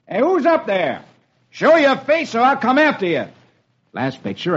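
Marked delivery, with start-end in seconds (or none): 0.1-3.2 higher, commanding call; challenging shout. A narrator's reading. Roy with guns drawn at unseen attacker, challenging shout. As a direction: call a threat up to someone far off. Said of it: "looks up the hill and shouts".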